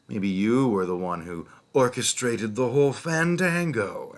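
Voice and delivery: high, loud sarcastic accusation; a hint of a smug detective; mock accusing cop. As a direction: sneer the accusation at the victim. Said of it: "they were like".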